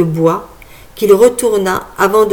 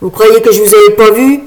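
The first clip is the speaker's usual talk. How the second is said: put-on gruff old man; 7 dB louder, faster than usual. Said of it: "reprit le vieux".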